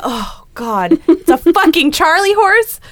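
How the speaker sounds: loud, slow alarmed exclamation; realizing the cause in a rush. Retold or imitated. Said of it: "And then I'm like".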